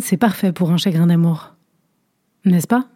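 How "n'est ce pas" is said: ordinary delivery, nothing marked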